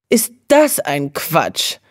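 slow, scornful drawl; contemptuous. A narrator's reading. Mariechen scoffing at the others' fear, contemptuous. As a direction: sneer at the nonsense. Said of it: "sagte Mariechen verächtlich".

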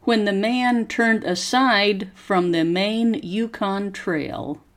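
slow, careful story reading for students to repeat; slow model reading. Shown as a demonstration. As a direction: pause after each phrase and lift the tune clearly. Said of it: "Copy how my voice goes up and down".

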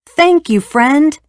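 high, loud, joyful cheer. Acted. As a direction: shout your thanks to the hero. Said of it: "they cheered".

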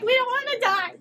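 high, loud comic wail; mock panicked soldiers. Imitating everyone not wanting to die, mock panic. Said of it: "They're like".

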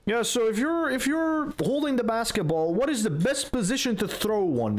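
lightly imitating a gym teacher quizzing kids; mocking the gym teacher. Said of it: "he would ask eight-year-olds, like".

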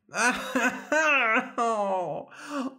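high cackling laugh trailing into oh; harder fairy laugh. A narrator's reading. Fairy laughing at the king's plight, high hard laugh. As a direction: burst into big theatrical laughter. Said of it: "The fairy laughed even harder this time".

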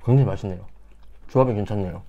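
speaking between bites; chewing while talking. Real, not acted. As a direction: mouth partly full of food. Seen 0: Cheeks bulge while talking at mealtime; he ate spoonfuls just before.

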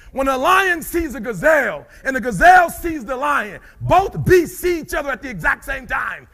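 high, loud shouted sermon; preaching at full force. Acted. Speaker firing up the crowd about lions, shouting high. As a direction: preach it like a revival.

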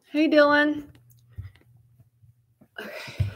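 normal talking voice

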